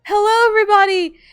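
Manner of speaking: distressed pleading yell. Retold or imitated. Markiplier yelling at the doubting dreamer, distressed. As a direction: plead frantically that you really are him. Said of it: "He's like, so distressed".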